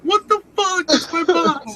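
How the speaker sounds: high mock-outraged cry; outraged yelp. Friend throws my box on the floor, mock outraged high cry. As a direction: retell your own indignant squeal.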